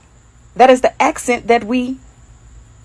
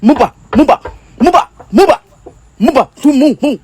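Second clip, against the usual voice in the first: loud nonsense chant in a fake African accent; hammed-up African accent on made-up words; mock African accent; 3 semitones higher, 5 dB louder than usual. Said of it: "with some type of accent if we all from Africa".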